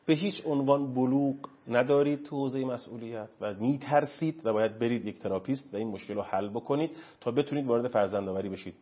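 usual speaking voice

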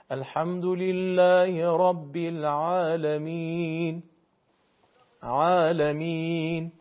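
slow chanted recitation, the ع in العالمین said without any throat pressure; Quranic recitation. Shown as a demonstration. Teacher demonstrating to a caller: slow recitation with an unforced ع in العالمین. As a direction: model العالمین with a light, relaxed ع. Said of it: "اصلاً هیچ فشاری نیست".

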